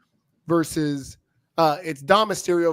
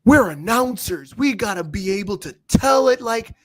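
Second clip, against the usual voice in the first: loud, higher mock protest; mock Michael Cole; 3 semitones higher, 5 dB louder than usual. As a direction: imitate an indignant announcer, raised voice. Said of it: "Michael Cole's like".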